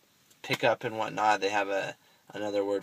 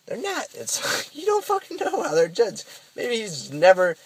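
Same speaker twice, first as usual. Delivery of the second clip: passionate outburst; 12 semitones higher, 4 dB louder than usual. Real, not acted. To listeners about judging others, a very high, heated protest. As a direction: voice jumps very high in exasperation.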